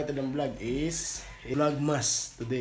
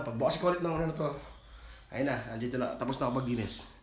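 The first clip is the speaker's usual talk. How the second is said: normal talking voice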